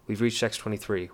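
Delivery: lightly voiced little girl shrieking with glee; a hint of a giddy child calling out; excited little girls. A narrator's reading.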